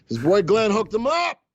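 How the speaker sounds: bragging about his own stock tip; proud brag. Shown as a demonstration. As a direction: crow about it to the chat.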